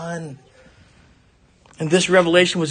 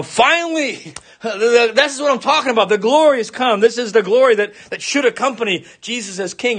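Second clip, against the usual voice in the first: raised, eager voice, lightly acted; a hint of Peter blurting it out in delight; Peter's excitement; 6 semitones higher than usual. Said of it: "He thinks".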